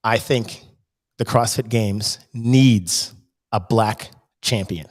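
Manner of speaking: slow, weighty announcement; a lightly voiced solemn Fraser; mock solemn declaration. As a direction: deliver it like a grave reveal. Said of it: "And he says".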